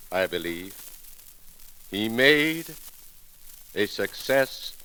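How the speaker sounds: ordinary talk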